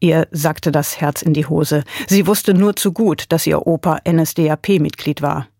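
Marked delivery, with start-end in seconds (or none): none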